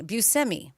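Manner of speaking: Buscemi as byoo-SEM-ee; plain s, not sh, in Buscemi; family's pronunciation. Shown as a demonstration. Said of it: "pronounced it Buscemi".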